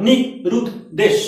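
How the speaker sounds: নি রুদ দেশ said in three clear beats; syllable by syllable. Shown as a demonstration. Teacher counting syllables for the class, paused. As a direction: tap out each syllable of নিরুদ্দেশ. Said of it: "তিনবার বললাম".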